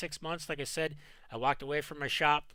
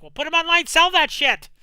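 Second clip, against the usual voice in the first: high, loud nagging wife; shrill-free loud mock of the wife; 14 semitones higher, 15 dB louder than usual. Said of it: "wife is like".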